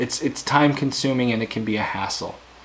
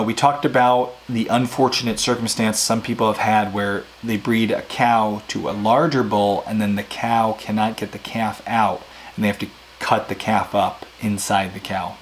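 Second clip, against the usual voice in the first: ordinary talk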